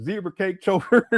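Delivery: ordinary talk, nothing marked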